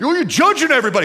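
high, fast mock whine at God; mock whiny complaint. Mimicking frustrated people, high fast mock whine. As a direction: squeal the complaint like a sulking child.

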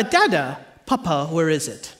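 put-on low, softened toddler question; lightly voiced little daughter. Toddler daughter asking dad for her pacifier, lightly voiced. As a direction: ask it lightly as a small child. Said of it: "she'll typically say".

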